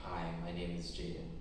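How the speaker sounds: low, soft, reluctant answer; understated, hesitant self-introduction; reluctant reply. Retold or imitated. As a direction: offer the line reluctantly, quietly. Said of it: "I'd reply reluctantly".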